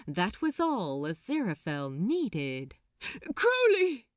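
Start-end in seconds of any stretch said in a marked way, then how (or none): 3.3-4.1 loud call across the deck; an angel calls out to his friend. Acted. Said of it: "he called".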